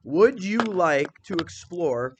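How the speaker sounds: Would you like to explore read slowly, word by word; slow model reading. Shown as a demonstration. Teacher models a slow, careful read of the sentence. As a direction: read it out slowly for the class to check. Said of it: "What am I modeling for you?".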